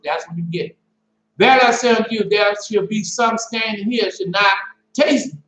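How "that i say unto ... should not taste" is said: scripture reading. Acted. Preacher reading the verse to his listeners, measured. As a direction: read the verse like it's weighty.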